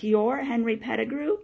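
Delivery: loud, high, rising disbelief; astonished question. Acted. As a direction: ask it in sudden amazement. Boy realises who man is.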